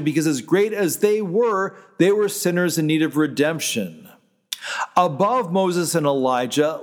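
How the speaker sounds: ordinary delivery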